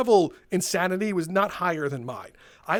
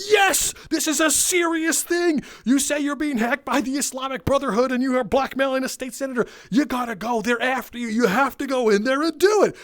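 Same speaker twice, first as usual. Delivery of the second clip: mock urgent; 6 semitones higher than usual. Urging the customer to go.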